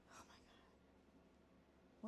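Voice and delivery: slow, whispered shock; breathless whisper. Real, not acted. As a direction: breathe it out in dismay. Shocked dog is gone.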